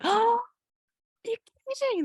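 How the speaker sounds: high, loud delighted disbelief; thrilled disbelief at the news; surprised. Retold or imitated. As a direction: gasp it out in amazement.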